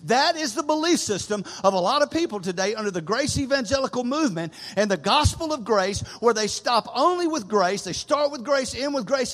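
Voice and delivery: loud, high-pitched pulpit preaching; preaching at full force. Acted. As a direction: drive it hard to the whole congregation.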